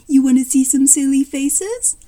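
put-on lightly done baby talk. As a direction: coo playfully at a baby, rising at the end. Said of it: "Baby talk".

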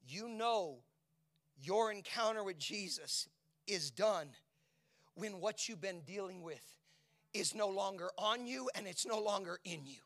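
high, loud, ringing preaching; preaching at full force. Acted. Pastor urging the church to hold on to God, full-force preaching. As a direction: shout it over the music like an altar call. Altar call preaching crescendo.

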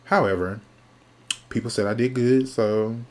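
ordinary talk, nothing marked

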